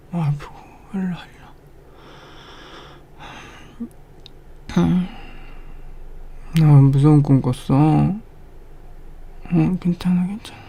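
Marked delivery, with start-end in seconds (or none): 0.1-3.3 soft whisper, coaxing them closer; soothing whisper. Acted. Whispering to a partner who had a nightmare, soothing. 9.5-10.6 reassuring whisper. Acted. Whispering to a crying partner, reassuring. Comforting partner after a nightmare.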